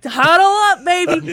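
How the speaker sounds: loud, high game-hero yell; hyped rallying shout. Retold or imitated. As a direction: shout it like rallying the team mid-fight. Acts out the game's huddle call.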